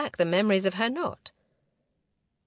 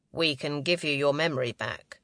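lower, lightly acted man's voice; a hint of a commander's lower register; lightly voiced male leader; 5 semitones lower than usual. A narrator's reading. Man telling Romy they came to save her, lightly voiced lower.

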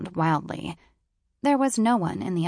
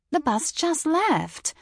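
worried British woman; 10 semitones higher, slower than usual. A narrator's reading. Voices the British office woman.

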